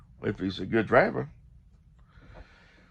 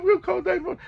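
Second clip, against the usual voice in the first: mock panicky caller; 5 dB louder than usual. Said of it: "And he's like".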